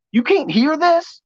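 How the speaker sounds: high, slow disbelieving question; amazed disbelief. Retold or imitated. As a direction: ask slowly, stunned, pitch up. Amazed at crickets.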